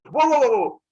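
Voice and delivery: loud, high run of whoas; amazed interruption. Retold or imitated. Zeus hears of weapons that kill gods, loud astonishment.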